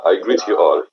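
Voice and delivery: normal talking voice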